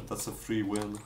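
ordinary talk

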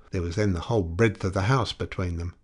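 usual speaking voice

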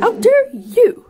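high, slow mocking echo. Echoing the game's line.

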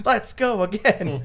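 high, slow, keen call to go on; a hint of a tireless actress; imitating Mako's actress asking to go again; eager actor. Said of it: "get back and be like".

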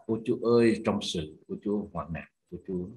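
ordinary talk, nothing marked

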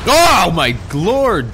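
surprised. Real, not acted. Startled by something in game.

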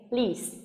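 "lease" with a long tense ee, falling; long tense vowel. Shown as a demonstration. Teacher showing the long vowel, tense "lease". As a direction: stretch the ee in "lease", end on s. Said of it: "nguyên âm y dài căng miệng".

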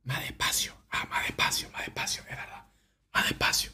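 unvoiced close-mic whisper; soft ASMR whisper. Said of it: "voy a volver a A S M R".

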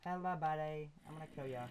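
playful hint of a British accent. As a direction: greet them in a light mock-British accent. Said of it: "talking in a British accent".

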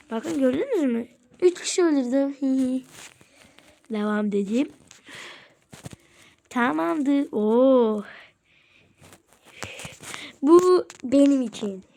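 usual speaking voice

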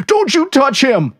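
high, slow protective shout; cried out. A narrator's reading. Trucker's friend crying out to protect him. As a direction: shout to keep him away. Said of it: "one of his friends cried".

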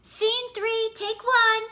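loud high slate call; yelled slate. A narrator's reading. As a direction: shout the slate out.

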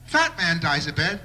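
ordinary talk

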